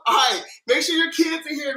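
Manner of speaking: usual speaking voice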